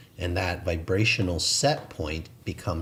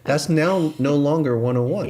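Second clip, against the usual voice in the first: louder and higher through a laugh; 8 semitones higher, 7 dB louder than usual. Real, not acted. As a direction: laugh the line out with delight. Laughing at own point.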